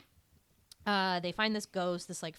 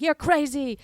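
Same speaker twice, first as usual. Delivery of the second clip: mock scolding; 8 semitones higher, 7 dB louder than usual. Quoting Aggie.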